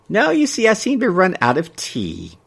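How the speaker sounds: lightly voiced elderly woman; prim old busybody; old gossip lady voice. Mabel voiced.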